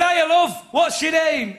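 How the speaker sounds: hammed-up friendly hello; friendly northern hello. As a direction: chat her up cheerfully. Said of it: "I said".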